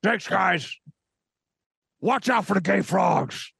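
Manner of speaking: high raspy ranting host; Alex Jones voice. Acted. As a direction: rant like a conspiracy radio host. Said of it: "I don't like how good this voice is".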